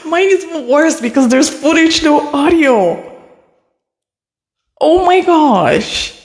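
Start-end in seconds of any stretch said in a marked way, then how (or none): 2.0-6.2 slow, drawn-out, high despair; slow groan of disbelief at no audio. Real, not acted. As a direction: stretch it out, stunned. Despair over lost audio.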